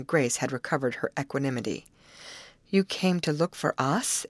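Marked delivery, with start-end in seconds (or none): none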